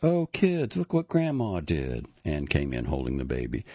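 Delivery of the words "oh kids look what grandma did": high, louder exclamation falling away; excited mother to kids. A narrator's reading. Anne showing the kids Grandma's cereal, excited. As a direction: sing it out to the children.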